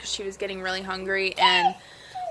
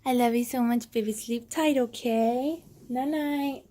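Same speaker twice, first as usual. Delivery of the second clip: sweet nothings; 4 semitones higher than usual. Real, not acted. Mom putting baby to bed.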